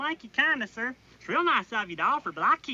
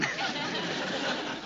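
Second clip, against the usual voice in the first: giggle; 2 semitones higher, 6 dB quieter than usual. Real, not acted. Recruit giggles again.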